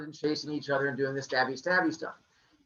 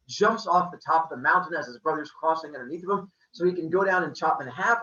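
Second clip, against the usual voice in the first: plain reading voice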